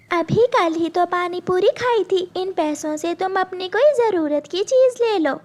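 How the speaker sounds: put-on two girls arguing at a snack cart; squabbling little sisters. Gudiya and Meena bicker over pani puri, high childlike voices.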